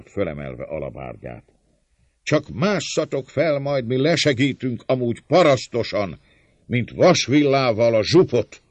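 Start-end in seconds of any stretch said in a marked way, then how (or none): 2.2-8.6 loud, high-pitched threat; defiant shout. A narrator's reading. Servant leader daring the attackers to climb, shouting. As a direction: shout a fierce taunt down at attackers. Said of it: "kiáltta".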